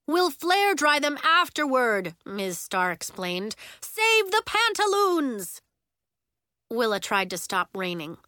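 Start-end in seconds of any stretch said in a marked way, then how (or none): 0.1-2.1 high, loud hurried explanation; urgent, raised teacher's voice; calling out. A narrator's reading. Teacher still yelling over the rain. 3.8-5.6 high, loud, drawn-out battle cry; rally them with a dramatic yell; melodramatic, high-pitched command; urgent shout. A narrator's reading. Teacher shouts in emergency.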